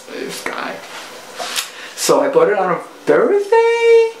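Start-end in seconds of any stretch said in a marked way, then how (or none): 0.1-0.8 whispered aside. Retold or imitated. Muttering her private reaction.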